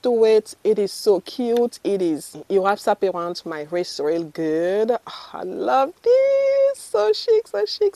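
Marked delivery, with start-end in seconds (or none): 4.9-7.9 high, loud delighted squeal; gushing delight. Real, not acted. To viewers about a bracelet, squealing with delight.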